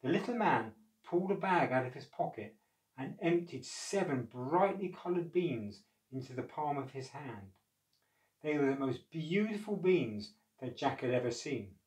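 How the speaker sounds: ordinary delivery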